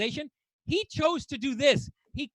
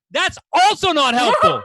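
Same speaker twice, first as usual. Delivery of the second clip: loud, higher exasperated cry, hitting "helpful"; hammed-up frustration at useless advice; exasperated punchline; 4 semitones higher, 10 dB louder than usual. Punchline repeat of complaint.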